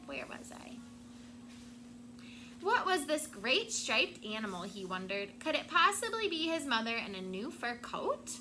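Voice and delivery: ordinary delivery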